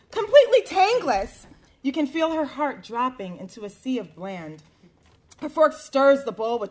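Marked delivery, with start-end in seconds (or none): 0.1-1.5 picky customer voice. Acted. Customer quoted, far timbre.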